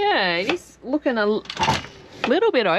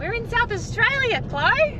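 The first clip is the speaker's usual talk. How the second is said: much higher excited announcement; 13 semitones higher than usual. Real, not acted. Announcing new state to child.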